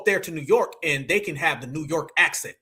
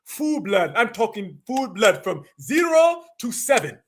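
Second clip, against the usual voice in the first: slow put-on African accent; mock African accent; 3 semitones higher, slower than usual. Host mimics people straight from Africa, slow mock African accent. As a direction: imitate a claimant in a thick African accent. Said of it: "people straight from Africa talking about some".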